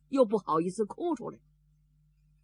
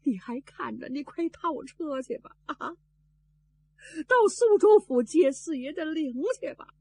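crying while instructing; 7 semitones higher than usual. A narrator's reading. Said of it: "一边哭一边吩咐管家".

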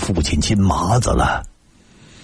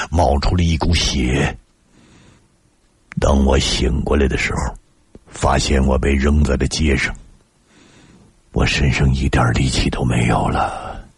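ordinary delivery

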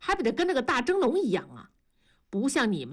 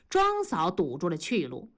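plain reading voice

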